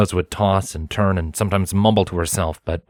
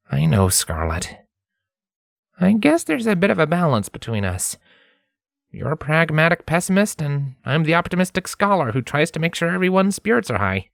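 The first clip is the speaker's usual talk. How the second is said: raised voice, light and upbeat; Lens, cheerful; 9 semitones higher than usual. A narrator's reading. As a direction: ease the tension with a joke.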